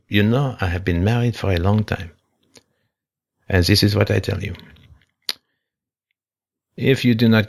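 put-on old Frenchman's heavily accented English, measured with long pauses; bad French accent. Old French guy advising a younger man on marriage, bad French accent. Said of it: "He had a bad French accent, that old guy. I imitated him perfectly".

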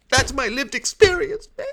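very high, whining insistence; hammed-up stubborn pupil; mock indignant protest. Kid insists to the teacher, mock indignant protest.

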